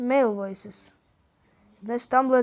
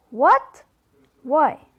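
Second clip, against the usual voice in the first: shocked; 3 semitones higher, 6 dB louder than usual. Acted. Roxy hears Lil can't move.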